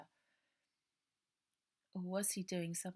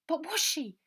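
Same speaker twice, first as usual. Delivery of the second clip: much higher, louder desperate question; urgent Ida; 10 semitones higher, 10 dB louder than usual. Acted. Ida begging Robert about Bonnie, urgent. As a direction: demand an answer now.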